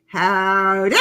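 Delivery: loud, low drawn-out cowboy howdy; Tammy's howdy. Retold or imitated. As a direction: belt a lazy country howdy. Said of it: "Tammy's like".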